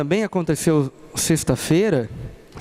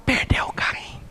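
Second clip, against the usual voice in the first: hushed whisper to his wife; 4 dB quieter than usual. Retold or imitated. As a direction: whisper it so the boy won't hear. Whispering the reason.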